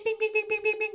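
high-pitched bing imitation; ping like a phone notification; phone ping noises.

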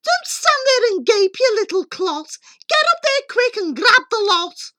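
high, loud excited mother; yelling out loud. A narrator's reading. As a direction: yell orders in greedy excitement. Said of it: "She yells out loud".

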